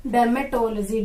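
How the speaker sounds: Dermatology as derma-TOL-jee; corrected pronunciation. Shown as a demonstration. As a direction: join the L to "to" in Dermatology, then jee. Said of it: "क्या कहते हैं?". (Dermatology को डर्मेटोल-जी बोलकर)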